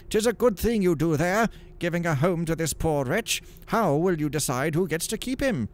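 monk's voice. Acted. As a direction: play the kindly friar. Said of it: "Brother Bernard said".

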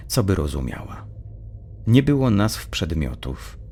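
usual speaking voice

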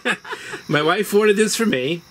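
chuckling through the words; laughing. Real, not acted. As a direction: laugh as you begin the story. Laughing as he starts the story.

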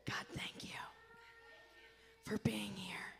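put-on breathy prayer whisper for the kids to echo. Shown as a demonstration. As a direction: whisper it softly so they copy. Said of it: "Whisper after me".

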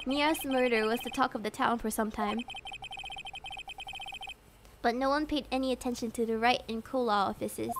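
low, soft scene-setting; subdued inner narration. Acted. Phoenix recalling the month since the trial, subdued. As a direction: recount it quietly to yourself.

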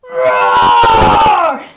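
deep, shouted lion roar; loud lion roar. Acted. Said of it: "Rory wants to sing loud".

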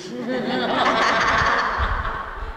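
soft, high mock chuckle; demon's laugh. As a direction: imitate a demon snickering, high and quiet.

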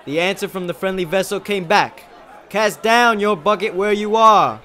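high, loud, fervent declamation; shouted oration. Acted. Student extolling a great leader, shouted speech. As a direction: project it like an earnest orator. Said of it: "I shouted".

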